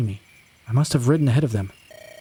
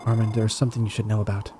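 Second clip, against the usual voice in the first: usual speaking voice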